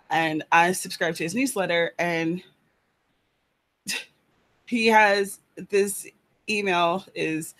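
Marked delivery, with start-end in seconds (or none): none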